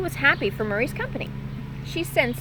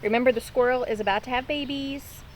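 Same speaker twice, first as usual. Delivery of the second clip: usual speaking voice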